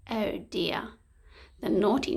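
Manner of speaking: usual speaking voice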